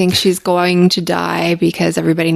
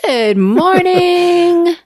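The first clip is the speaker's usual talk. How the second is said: high drawn-out greeting; 12 semitones higher, 4 dB louder, much slower than usual. Real, not acted.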